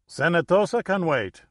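put-on light Dutch-accented English; a hint of a Dutch accent. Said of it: "his speech betraying a Netherlands origin".

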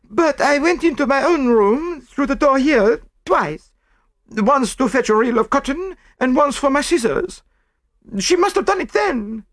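very high, excitable female voice; defensive French maid. A narrator's reading. French maid explains leaving the room, defensive. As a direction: explain yourself in a high, protesting voice.

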